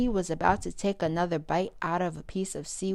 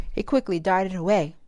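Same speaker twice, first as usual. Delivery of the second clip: plain reading voice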